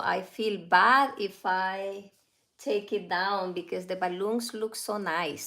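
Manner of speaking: ordinary talk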